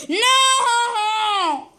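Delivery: high shocked cry. Pretend. Child toy refusing the belt, high cry. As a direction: cry out in mock terror.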